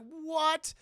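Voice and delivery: loud, high-pitched disbelief; mock astonishment. Man learns they are siblings, loud astonished question. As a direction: react with exaggerated shock.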